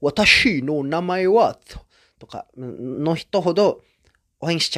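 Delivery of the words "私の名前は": slow, careful beginner's Japanese; a hint of a slow foreign learner's Japanese; halting beginner Japanese. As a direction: spell out each syllable like a fresh learner. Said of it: "発音とかもまだ完全に".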